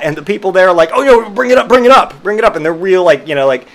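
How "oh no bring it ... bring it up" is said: high, loud hurried urging; hammed-up emergency rush; mock urgent staff. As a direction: vet staff waving it in, mock alarm.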